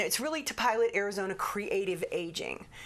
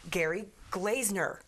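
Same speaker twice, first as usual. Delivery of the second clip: usual speaking voice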